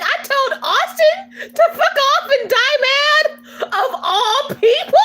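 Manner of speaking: high, slow disbelief through laughter; mock incredulous. Disbelieving retelling of own words.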